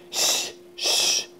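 soft unvoiced shh; whispered shush. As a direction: hush the others to keep the secret. Friends hiding a surprise.

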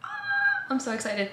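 ordinary talk

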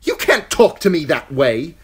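put-on high, loud, whining boy king; petulant tantrum. Boy king shouting at his uncle, high and shrill. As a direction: throw a royal tantrum.

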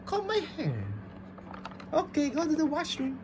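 high, soft doll voice; little troll character. Pretend. Toy troll off to comb its hair, high pretend voice.

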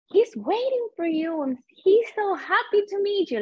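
high, excited retelling of the host; eager announcement. Retold or imitated. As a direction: pitch it way up, bubbly and eager. Quoting the host's announcement.

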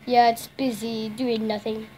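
ordinary delivery

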